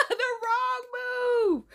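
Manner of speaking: high, very slow laughing cry; laughing wail. Acted. Reacting to the ghost story, slow laughing wail.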